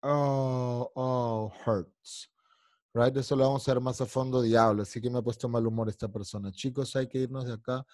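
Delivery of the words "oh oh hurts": held "oh" sound leading down into "hurts"; kitten trick. Shown as a demonstration. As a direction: draw out "oh" like a little cat, then say "hurts". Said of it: "el truquito del gatito".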